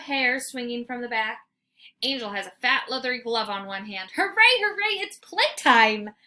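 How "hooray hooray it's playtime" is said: loud, high, joyful bark; barking excitedly. A narrator's reading. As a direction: yelp it out with glee. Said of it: "I bark".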